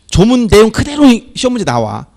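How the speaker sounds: ordinary talk, nothing marked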